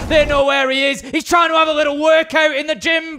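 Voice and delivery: high, loud esports call, key words stretched; excited play-by-play. Real, not acted. Caster hailing a great round for viewers, excited play-by-play. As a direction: roar the praise, then keep the action driving.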